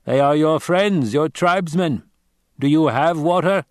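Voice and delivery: a grave stranger giving a warning; Fremen warrior. Acted. Narrator voices the Fremen.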